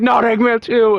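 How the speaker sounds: mock dismay. YouTuber despairing as Eggman joins the roast, mock wail. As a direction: wail it like the last straw.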